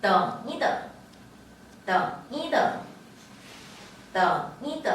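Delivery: 떵이더 with 이 short and unstressed between the verbs; neutral-tone middle syllable. Shown as a demonstration. Teacher drilling wait a moment, 떵이더 with a light 이. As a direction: pass lightly over 이 in 떵이더. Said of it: "경성으로 된다라고 했는데요".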